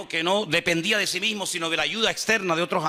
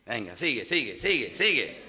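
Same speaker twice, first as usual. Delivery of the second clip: sly egging on; 6 semitones lower, 4 dB quieter than usual. Acted. Devil egging sinner on.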